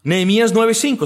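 ordinary talk, nothing marked

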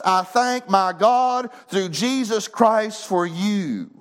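impassioned sermon delivery; fervent preaching. Real, not acted. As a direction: build to a high proclamation, stretching out the scripture. Preacher rises into fervent proclamation.

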